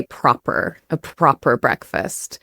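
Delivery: proper with the r sounded at the end; American proper. Shown as a demonstration. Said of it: "in kind of the more American English".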